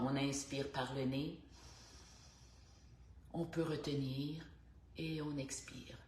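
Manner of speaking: unhurried instructor voice with long pauses between cues; guided stretching. Real, not acted. Instructor guiding women through morning neck stretches, unhurried guided stretching. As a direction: stretch key words out in time with the breath.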